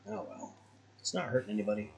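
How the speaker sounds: normal talking voice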